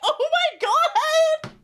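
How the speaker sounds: very high, slow, laughing gasp of shock. Retold or imitated. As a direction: squeal it out in disbelief. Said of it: "And I'm like".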